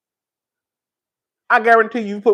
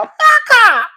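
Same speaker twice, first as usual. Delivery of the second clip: high, loud, slow and laughing; mock-furious outburst drawn out slowly; dramatic going up; 13 semitones higher, 5 dB louder, much slower than usual. Shown as a demonstration. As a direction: flare up high and loud, dragging it out. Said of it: "a whole bunch of going up".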